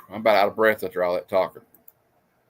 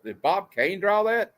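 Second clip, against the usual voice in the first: ordinary talk, nothing marked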